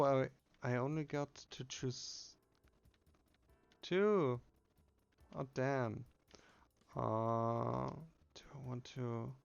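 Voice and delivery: ordinary talk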